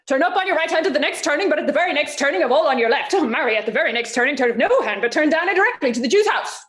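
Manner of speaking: fast, tumbling directions; rapid nonsense directions. Acted. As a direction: rattle it off to confuse him. Launcelot confusing his father.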